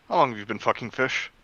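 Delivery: usual speaking voice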